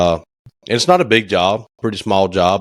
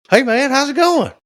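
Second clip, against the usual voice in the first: much higher, lightly put-on voice of the builder; imitating Frank. The builder Frank greeting him on the phone, high mock voice. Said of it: "He goes".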